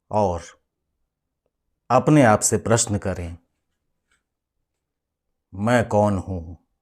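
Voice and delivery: usual speaking voice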